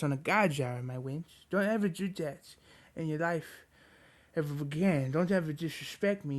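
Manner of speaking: soft, playful scolding of a pet; mock scolding. Real, not acted. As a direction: gently tease the dog for pushing the mic. Mock scolding his dog gently.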